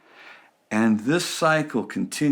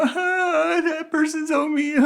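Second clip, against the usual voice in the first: much higher whining gossip; whiny complainer; 14 semitones higher, slower than usual. Retold or imitated. Complainer telling the pastor about someone. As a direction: pout it like a tattletale. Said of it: "if you come to me and go".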